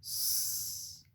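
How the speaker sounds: long sustained hiss for "S"; pure held sss on "S"; isolated S sound. Shown as a demonstration. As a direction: say "S" as a drawn-out sss, not the letter name. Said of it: "fera le son S".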